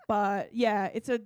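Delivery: normal talking voice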